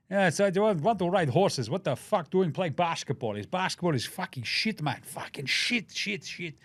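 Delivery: lightly put-on Serbian accent, grumbling; a loose Serbian-accent Jokic grumble; mock Serbian accent. Podcaster imitates Jokic in a loose Serbian accent. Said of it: "his accent changed about five times".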